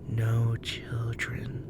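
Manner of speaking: slow eerie whisper; disappointed whisper. Acted. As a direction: let it sink, ancient spirit. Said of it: "she repeated".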